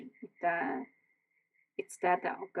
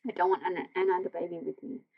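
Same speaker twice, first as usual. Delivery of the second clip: insistent, louder plea; raised voice; 7 dB louder than usual. A narrator's reading. Mother refusing daughter's plan, loudly insistent. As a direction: Mum pushes back, voice raised. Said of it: "Mum says".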